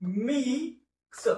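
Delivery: drawn-out, high model of मिक्स; slow word model. Shown as a demonstration. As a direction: stretch मिक्स slowly for students to copy. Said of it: "शब्दाचं वाचन करा". (मिक्स हळू, ताणून उच्चार)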